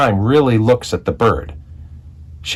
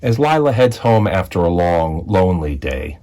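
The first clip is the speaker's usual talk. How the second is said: plain reading voice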